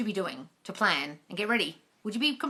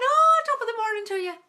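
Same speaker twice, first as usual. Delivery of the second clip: loud, high-pitched mock Irish accent; terrible Irish accent; 5 dB louder than usual. Shown as a demonstration. Greeting a live viewer from Ireland in a terrible Irish accent. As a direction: put on a bad, exuberant Irish accent. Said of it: "My terrible Irish accent".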